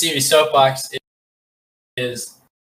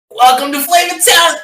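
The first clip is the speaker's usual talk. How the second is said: high, loud shout of a catchphrase; yelled catchphrase; 14 semitones higher, 7 dB louder than usual. Acted. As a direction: yell it out like a TV host at his climax. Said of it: "he just yells".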